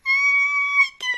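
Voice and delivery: high squealing coo of delight; real delighted squeal. Real, not acted. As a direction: squeal over something adorable. Cute creature spotted.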